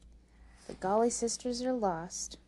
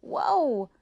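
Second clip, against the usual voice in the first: raised, loud call, fairly short; 7 semitones higher, 8 dB louder than usual. Acted. Rose giving the horse word for stop, calling out.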